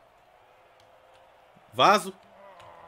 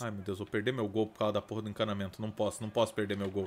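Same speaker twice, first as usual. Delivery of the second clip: ordinary talk, nothing marked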